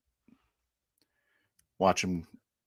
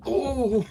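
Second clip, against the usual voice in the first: high-pitched, drawn-out oh. Acted. Pilot reacting to the blast, high oh. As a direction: let out a high oh.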